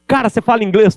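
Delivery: high, delighted surprise; a foreigner thrilled you speak English; surprised American asking. Retold or imitated.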